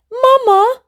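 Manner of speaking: high bright call to mom. Acted. Child calling his mama excitedly. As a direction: call across the room to Mama. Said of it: "he calls out".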